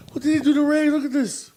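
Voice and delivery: high-pitched, distraught questioning; hammed-up upset squeal; mock dismay. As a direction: replay your horror, voice shot up high. Acting out shock at the body.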